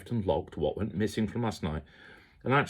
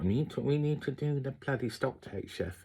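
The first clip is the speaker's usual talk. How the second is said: imitating Sammy in a rough Indian accent, pitched higher; a higher, put-on Indian accent for the colleague; mock Indian accent; 7 semitones higher, 5 dB quieter than usual. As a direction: Indian accent impression, a bit quieter. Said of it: "my accents are terrible".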